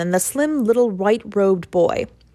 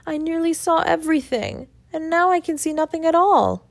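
sobbing; 9 semitones higher than usual. A narrator's reading. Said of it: "left him sobbing bitterly".

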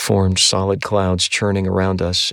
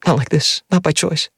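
choked plea; 9 semitones higher than usual. A narrator's reading. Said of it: "Addie choked out in barely recognizable gasps".

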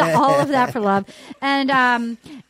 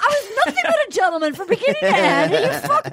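high-pitched indignant mock protest; [name]; 7 semitones higher, faster than usual. Wronged gentleman complaining to everybody, mock outrage. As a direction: plead his case in a raised, exasperated voice.

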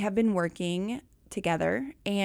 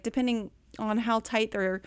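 ordinary talk

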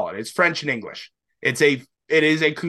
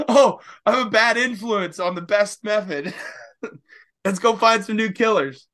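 laughing mock quote; 8 semitones higher than usual. Retelling a grower's message to a co-host, laughing and higher. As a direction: play it up as a half-laughing, guilty friend. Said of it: "It's like".

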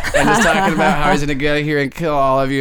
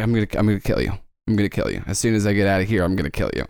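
low, quiet repeated threat; a hint of a menacing captive; 6 semitones lower, 3 dB quieter, much faster than usual. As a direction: growl it low like a promise. Trigus threatens the party.

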